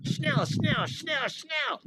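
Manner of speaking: high urgent repeated Schnell; mock German order. Joking about angry German, barking schnell. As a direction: snap it like a drill sergeant. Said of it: "an angry sounding German word".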